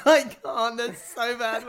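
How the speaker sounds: high, giggly, helpless protest; real giggles breaking up the words; laughing. Real, not acted. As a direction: laugh through the words as you give up. Giggling while failing to read Irish.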